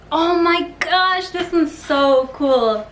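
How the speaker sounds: slow, drawn-out awe; awed. Real, not acted. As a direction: marvel at it slowly. Excited over finished brownies.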